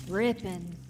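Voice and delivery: ordinary delivery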